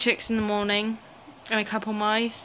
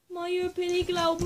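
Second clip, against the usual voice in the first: squeaky praising a dog; 8 semitones higher, faster than usual. Real, not acted. Gushing over owl.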